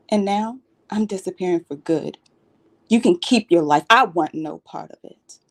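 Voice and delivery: wounded confrontation, pitch lifting on the pleas; hurt, accusing daughter. Acted. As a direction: confront a neglectful parent, pausing between the pleas. Audition monologue, daughter confronting parent.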